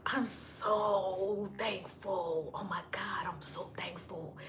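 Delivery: slow breathy whisper of thanks; moved whisper. Real, not acted. Thanking a viewer's comment in a moved whisper. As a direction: whisper the thanks, near tears. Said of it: "you're gonna make me cry".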